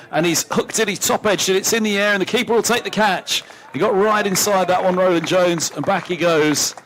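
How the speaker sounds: rising excitement as the catch is taken; excited wicket call. Real, not acted. Wicket falls, live commentary.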